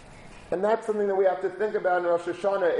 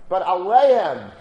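aleim drawn out slowly and much louder; stressed aleim; 8 dB louder, slower than usual. Real, not acted. Preacher stressing that aleim means on them personally, slow and loud. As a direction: lean hard and slow on aleim.

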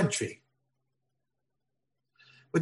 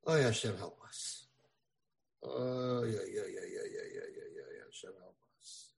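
fading prayer; 4 semitones lower, 11 dB quieter than usual. Acted. Praying, fading away.

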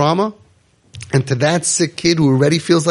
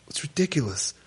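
usual speaking voice

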